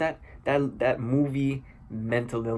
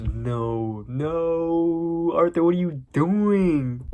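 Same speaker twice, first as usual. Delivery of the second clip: drawn-out wail, rising higher and louder; dismayed outcry; 5 semitones higher, 4 dB louder, much slower than usual. Retold or imitated. Moviegoer pleading with Arthur on screen, dismayed outcry. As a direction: cry out in exaggerated dismay.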